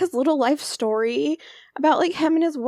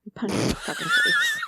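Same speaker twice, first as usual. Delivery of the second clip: laughing. Real, not acted. Squeaky laughing joke.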